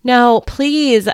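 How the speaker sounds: urgent, imploring cry; begging. A narrator's reading. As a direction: beg them desperately to relent. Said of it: "I begged".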